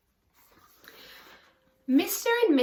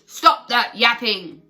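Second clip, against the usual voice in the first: booming; 9 dB louder than usual. Acted. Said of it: "Nobody boomed".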